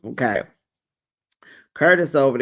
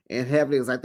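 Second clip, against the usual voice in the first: ordinary talk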